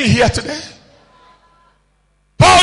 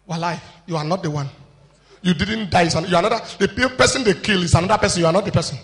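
muttered doubting voice; 7 semitones lower, 7 dB quieter, much faster than usual. Acted.

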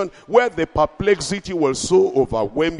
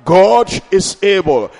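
rising preacher's declaration, louder; preaching; 3 semitones higher, 6 dB louder than usual. Acted. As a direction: proclaim it to the congregation. Preaching refrain.

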